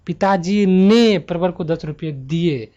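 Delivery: loud, slow पिताजी ने with a long, heavy ने; drawn-out emphasis on ने in पिताजी ने; stress on पिताजी ने. Shown as a demonstration. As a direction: punch पिताजी ने, then carry the sentence forcefully. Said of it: "यहाँ पे पिताजी ने".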